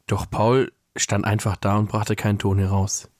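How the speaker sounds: measured read-aloud storytelling voice; story narration. A narrator's reading. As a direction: read the Christmas story aloud, pausing between sentences. Reads a Christmas story aloud.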